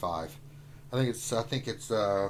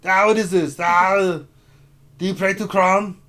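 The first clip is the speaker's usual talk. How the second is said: higher, loud barbarian ham; Conan barbarian voice; 7 semitones higher, 11 dB louder than usual. Pretend. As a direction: boom it out like a movie barbarian. Reacts to name, barbarian impression.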